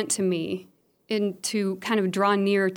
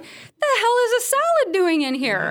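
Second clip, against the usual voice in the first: shocked disbelief; 12 semitones higher, 4 dB louder than usual. Retold or imitated. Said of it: "I'm like".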